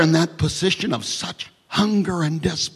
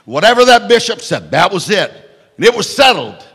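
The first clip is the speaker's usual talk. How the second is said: emphatic proclamation; 5 semitones higher, 6 dB louder than usual. Real, not acted. Preacher proclaiming bishop's authority.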